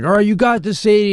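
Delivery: high, encouraging onlooker voice; imitated cheering on. Onlookers urging the young diver at practice, imitated encouragement. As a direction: cheer her on in a lifted voice.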